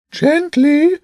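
high, loud pigeon character voice; scolding bishop bird. A narrator's reading. Bishop bird corrects James grinding ink, scolding. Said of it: "the bishop scolded".

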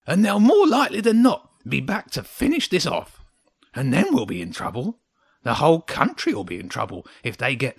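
emphatic, agitated little creature; grumpy hedgehog, warning. A narrator's reading. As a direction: warn of trouble in a hedgehog voice.

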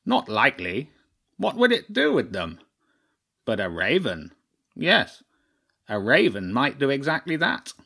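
pompous bird, scornful and then knowing; crow chairman, dismissive. Acted. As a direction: crow chairman leaping high on the questions.

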